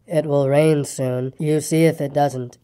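low, slow gloomy drone; gloomy donkey. A narrator's reading. Eeyore warning Roo of rain, slow gloomy drone. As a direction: drag it out low and glum.

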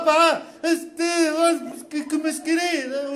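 very slow, high mock European Portuguese; Portuguese colonizer. Comedian imitating Portuguese colonizers greeting the natives. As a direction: drawl it in a stagey Portugal accent. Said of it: "os portugueses chegaram aqui e falaram".

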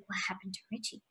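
very soft, low aside; small, hushed retold question; hushed suspense. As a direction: ask it under your breath, on edge. Retold suspense, first take hushed.

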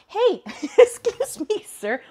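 high, loud, slow hey at the cat; mock-stern surprise. Real, not acted. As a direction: catch the cat in the act, mock offended. Cat climbs into her box.

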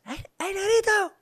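very high mock call; street sweepers calling. Sweepers calling out to him, high teasing. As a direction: sing it out teasingly. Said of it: "los de Interaseo".

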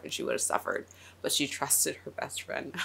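holding back tears. Real, not acted. Fan moved to tears by the friendship: choked-up hush. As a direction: barely get it out, close to crying. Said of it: "sorry, I'm trying not to cry".

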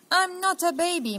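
put-on indignant toddler. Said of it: "muttered the little one".

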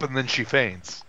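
ordinary delivery

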